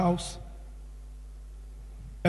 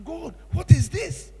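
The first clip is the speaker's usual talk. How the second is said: higher, slow wondering question; awed question to God; 9 semitones higher, slower than usual. Retold or imitated. Preacher reliving vision, asking God in slow high wonder. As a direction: ask God in amazement. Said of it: "I said".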